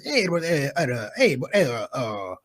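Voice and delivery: low, sputtering false starts; an engine failing to turn over in the cold; stammering father. Retold or imitated. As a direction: imitate an elderly dad who can't get words out. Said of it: "He sounded like this".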